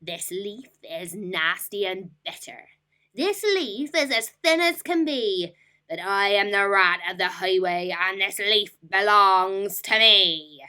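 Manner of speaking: loud, sneering robber rat; scornful rat. Acted. Rat scorning the rabbit's clover, loud sneer.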